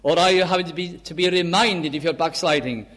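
emphatic sermon delivery with stretched key words; impassioned preaching. Real, not acted. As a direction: preach it fervently, weighting each phrase. Preaches with rising force.

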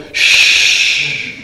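breathy shh of static; a crackling hiss over the station speaker; imitated loudspeaker hiss. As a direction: hiss out the cut-off announcement. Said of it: "he goes".

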